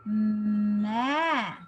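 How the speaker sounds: ordinary delivery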